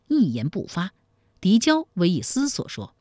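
plain reading voice

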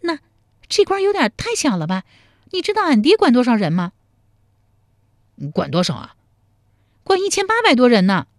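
put-on a feisty young woman grilling him; fierce young woman. A narrator's reading.

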